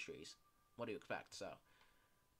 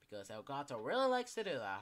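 ordinary talk, nothing marked

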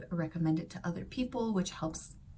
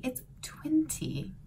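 twenty said British, crisp t, slow and whispered; slow British twenty with a clear t; British accent; 5 semitones higher, 3 dB quieter, slower than usual. Retold or imitated. Son correcting mom, British twenty. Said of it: "in British English, it's twenty".

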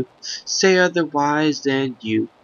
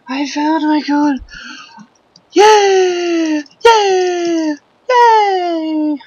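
very high, loud victory yells; triumphant high cheer; 14 semitones higher, 4 dB louder, slower than usual. Pretend. Gamer celebrating his find in squeaky high voice. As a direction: shriek with joy like a cartoon.

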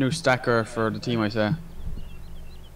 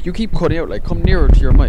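said again much louder; louder repeat; 6 semitones higher, 11 dB louder than usual. Real, not acted. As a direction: raise the voice so they hear.

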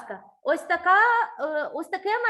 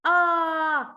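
one long, loud А; isolated held vowel; 6 dB louder than usual. Shown as a demonstration. Teacher naming the fourth sound for a child, long loud А. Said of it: "четвертий звук".

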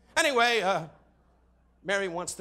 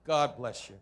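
usual speaking voice

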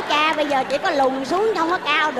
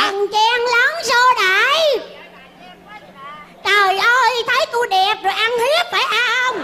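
indignant yelling; 6 semitones higher than usual. Acted. Newcomer complaining of pushing and shoving, indignant yell. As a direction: holler in protest at being jostled. Elder enters, complaining of being shoved.

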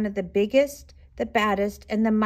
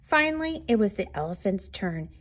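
usual speaking voice

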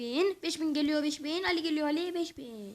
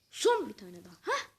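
usual speaking voice

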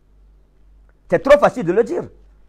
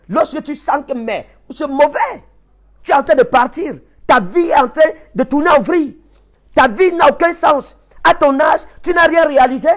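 high-pitched, urgent outpouring of despair; acted distress; 7 semitones higher than usual. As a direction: preach it as a life spinning out of control. Voicing a life falling apart.

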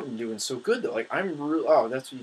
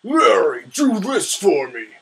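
loud, slow bossy boss voice; mock Bowser ordering Larry around; imitating Bowser; 6 semitones higher, 9 dB louder, much slower than usual. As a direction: bark orders like a tyrant king. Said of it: "Larry is the one Bowser asks".

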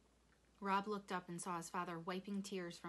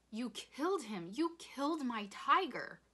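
loud high accusation, stretched out; angry scream; 6 semitones higher, 8 dB louder than usual. A narrator's reading. Rob attacking his father over the tiger, screaming. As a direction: accuse him at full voice. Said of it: "Rob screamed".

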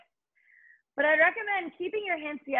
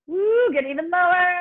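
loud coaching shout; 6 semitones higher, 6 dB louder than usual. Real, not acted. Coach urging during exercise.

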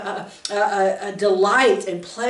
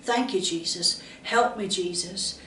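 granny's soft prayer; 3 semitones lower, 8 dB quieter than usual. Retold or imitated. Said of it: "That's usually what she'd say".

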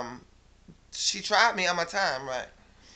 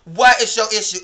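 loud exasperated demand, a bit less high; angry yell; 5 semitones higher, 12 dB louder than usual. Real, not acted. Still yelling at the friend over two pictures, exasperated. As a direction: throw the question back at her, louder.

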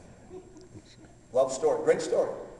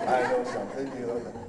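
lightly voiced playful taunt; a hint of a child's nyah-nyah; teasing singsong. God teasing the pastor, light singsong taunt. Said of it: "God's up there laughing".

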